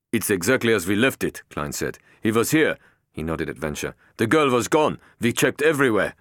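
security chief defends himself to his boss; security chief Klein. A narrator's reading. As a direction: insist you did your job. Narrator voicing Klein.

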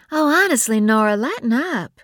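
high, loud eye-rolling dismissal; exasperated Bree. A narrator's reading. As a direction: tell her to relax, impatiently. Bree voiced by narrator.

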